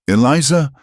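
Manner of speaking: high, loud sudden call of her name; alarmed call. Acted. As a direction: cry out in alarm as she stumbles. Kalen sees Eliza shot.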